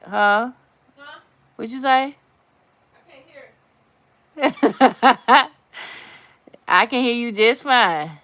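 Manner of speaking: loud, high shouted questions; calling out. Real, not acted. Woman yelling questions to her partner across the house. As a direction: yell it to someone far off.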